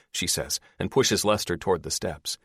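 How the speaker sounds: usual speaking voice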